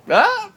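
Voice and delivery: very high, short "ah"; mock surprised gasp. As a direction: act out a startled little cry. Reacting to coworker's trimmed-down look.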